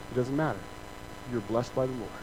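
calm them down kindly; lightly voiced gentle friend; gentle reassurance. [name] voiced answering gently.

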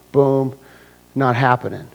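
ordinary talk

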